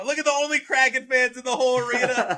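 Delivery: loud, high, fast laughing delight; excited laughing. Real, not acted. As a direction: laugh out the words, thrilled. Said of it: "I was very excited".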